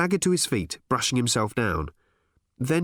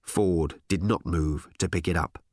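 usual speaking voice

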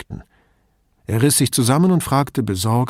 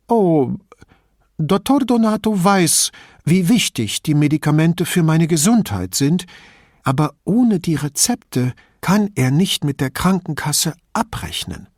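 put-on higher-pitched voice of a griping old lady; old lady, indignant and chatty; old signora; 6 semitones higher, 3 dB louder than usual. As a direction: play the suspicious old woman complaining about thieves.

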